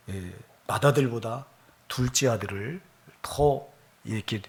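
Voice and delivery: ordinary delivery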